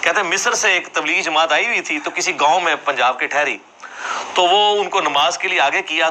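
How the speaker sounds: ordinary talk, nothing marked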